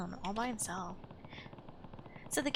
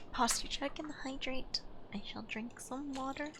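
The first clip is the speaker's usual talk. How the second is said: whisper; 4 semitones higher, 7 dB quieter than usual. Real, not acted. Thanks chat for reminder.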